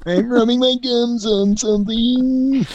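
very high squeaky voice; high falsetto. Acted. Comic falsetto bit.

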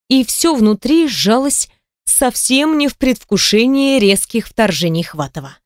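usual speaking voice

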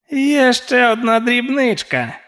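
slow, high crone voice; old witch. A narrator's reading. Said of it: "сказала стара". (повільний високий голос старої відьми)

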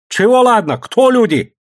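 high, loud, scornful retort; angry shouting. A narrator's reading. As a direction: throw their words back at them. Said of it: "закричал Сашка".

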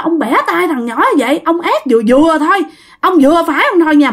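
high, heated berating; angry cursing. Acted. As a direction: tell someone off as an outraged father. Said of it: "bắt đầu đứng chửi các bạn".

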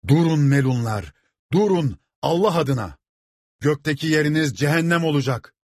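high, impassioned damning cry; shouted warning. A narrator's reading. Priest with a cross halts the rebels, shouting. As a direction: cry out to halt a mob in God's name. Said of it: "diye bağırarak".

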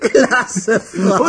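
words squeezed out through laughter; high and loud while still laughing. Acted. Friend laughing at the story.

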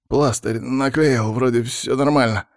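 usual speaking voice

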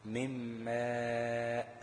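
ماء stretched very long, ending on a clear hamza; madd demo. Shown as a demonstration. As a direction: hold the alif in ماء long, then close on the hamza. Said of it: "لو هي مثلًا مكسورة الهمزة". (مد طويل في ماء مع نطق الهمزة)

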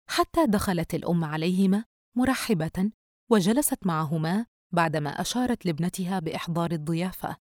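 ordinary delivery, nothing marked

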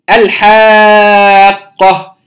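الحاقة with a clear ل and a very long, loud آ; إظهار اللام. Shown as a demonstration. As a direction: sound the ل, then stretch the vowel of "الحاقة" at length. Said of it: "نظهر اللام الساكنة". (الحاقة بإظهار اللام ومد طويل)

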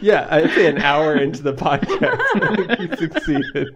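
words squeezed through laughter; laughing agreement. Real, not acted. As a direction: tease him while cracking up. Teasing him amid shared laughter.

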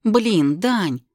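loud annoyed call after him; exasperated shout. Acted. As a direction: yell it in frustration. Heroine calling after him.

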